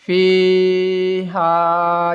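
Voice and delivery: فی ہا chanted very slowly with both vowels stretched; madd letters. Shown as a demonstration. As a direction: hold "fee" and "haa" long and level in فی ہا. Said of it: "یہ الف مدہ ہے".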